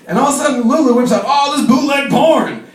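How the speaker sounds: usual speaking voice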